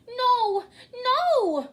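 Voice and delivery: a high, loud desperate scream; crying out. A narrator's reading. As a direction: scream in horror. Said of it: "cried Jane".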